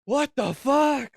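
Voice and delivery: high, loud, drawn-out disbelief; slow, stretched shout of mock shock; shocked moviegoer. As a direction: imitate a freaked-out audience member yelling. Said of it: "people are like".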